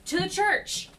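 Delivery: loud, high yell of alarm; panicked yell. Acted. Player makes their character call the party to safety, panicked yell. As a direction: shout it out in an acted panic. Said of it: "look panicked and just yell".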